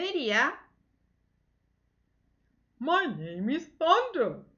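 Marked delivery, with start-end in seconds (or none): 2.8-4.4 a touch higher and louder, slow and heavy; strong Thunder voice. Acted. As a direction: play a powerful young hero stating his name. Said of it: "Le hago la voz así".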